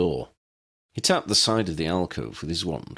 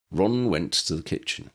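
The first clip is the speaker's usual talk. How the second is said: plain reading voice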